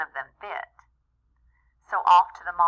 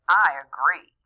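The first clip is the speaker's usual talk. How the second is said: sighing agreement; 3 semitones lower, 6 dB louder than usual. Acted. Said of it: "sighed and said".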